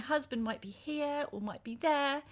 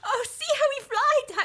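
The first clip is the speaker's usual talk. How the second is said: high, loud, exultant; ecstatic cry; 9 semitones higher, 6 dB louder than usual. Acted. Danu to Daksha as the cart flies, ecstatic. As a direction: soar with wild joy.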